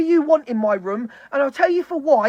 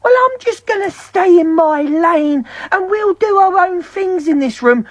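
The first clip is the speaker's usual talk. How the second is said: higher, put-on voice mimicking other streamers; mocking imitation; 6 semitones higher than usual. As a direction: mimic their self-righteous promise in a higher voice. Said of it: "People sit on their lives and goes".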